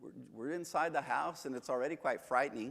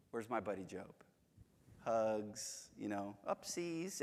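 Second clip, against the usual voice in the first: mock gentle God; 6 semitones lower, 10 dB quieter than usual. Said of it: "Not like".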